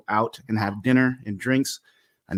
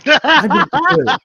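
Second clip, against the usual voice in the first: high, loud, slow mock confession with laughter; drawn-out high-pitched impersonation of a wife, laughing; wife's confession; 12 semitones higher, 9 dB louder than usual. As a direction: act out a wife owning up, cracking up. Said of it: "And she goes".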